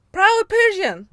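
high, loud, playful boast; proud declaration. Retold or imitated. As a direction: proclaim it like a catchphrase. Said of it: "As everyone say it".

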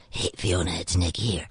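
low, quiet late-night phone voice; Nick Nolte. Acted. Actor phoning his dialect coach at three a.m., low impression. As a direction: drop right down into a sleepy-low male star's voice. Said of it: "Nick Nolte used to phone me".

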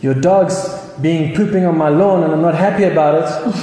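a hint of a sulky super villain; grumpy villain complaint. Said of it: "I'm not gonna try to do the Gru accent".

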